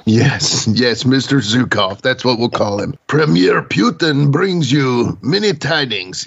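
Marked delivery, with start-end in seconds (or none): 3.1-6.2 put-on Russian accent. Resumes joking Russian bit.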